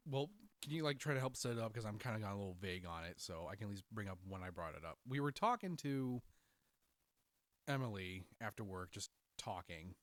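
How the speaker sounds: normal talking voice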